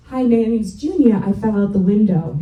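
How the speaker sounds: loud little-kid announcement, imitated; imitated child's introduction. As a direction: blurt it out loudly as a child would. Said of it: "introduce herself like this".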